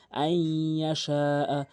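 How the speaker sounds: "আই ইয়া শা আ" slow, with "আই" held nasally; recited with ghunnah. Shown as a demonstration. Teacher shows required ghunnah, slow long "আই ইয়া". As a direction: stretch the nasal y between "আই" and "ইয়া". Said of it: "গুণনা করতে হবে".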